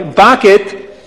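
put-on accented "pocket" with B for P; accent turning pocket into "bocket"; Abdallah's accent. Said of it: "I'm sorry".

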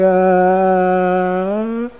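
slow, wailing, half-sung lament; a hammed-up sobbing wail; mock crying king. King cries in bed over his wife, slow mock wail. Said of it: "started to cry".

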